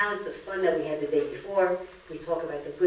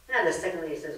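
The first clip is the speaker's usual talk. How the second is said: ordinary talk, nothing marked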